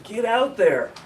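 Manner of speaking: normal talking voice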